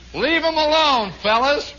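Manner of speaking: high projected order; sergeant's command. Acted. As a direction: call the men off, acted. Sergeant addressing the men, acted.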